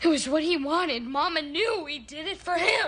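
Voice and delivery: high-pitched, urgent pleading; distraught, pitched well up; desperate confession. Acted. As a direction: plead to justify what was done. Confession pleaded in a family quarrel.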